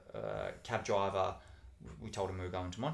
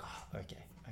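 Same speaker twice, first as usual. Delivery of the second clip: whispered okay; 12 dB quieter, faster than usual. Retold or imitated. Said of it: "then they'll be like".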